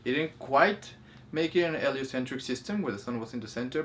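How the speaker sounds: ordinary talk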